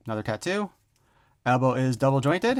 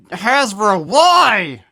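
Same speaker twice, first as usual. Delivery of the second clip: Hasbro, why, cried out high and loud; exasperated cry; 6 semitones higher, 10 dB louder, much slower than usual. Real, not acted. Reviewer shouts at Hasbro over the missing glow plastic, exasperated cry. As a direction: throw a despairing shout at Hasbro. Exasperated plea to the toy maker.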